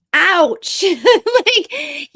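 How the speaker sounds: a high, loud ouch breaking into a laugh; teary laugh. Real, not acted. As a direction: laugh off the sting in the throat. Choking up, laughs it off.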